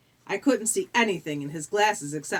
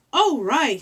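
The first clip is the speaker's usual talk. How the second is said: loud, higher, eager agreement; chiming in; 6 semitones higher, 8 dB louder than usual. Acted. Grover chimes in to back his friends, chiming in eagerly. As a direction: pipe up brightly and loudly. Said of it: "he chimed in".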